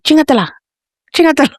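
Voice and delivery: lightly hammed-up exasperated mother; mock scolding mom. Yelling mom eggs dad on.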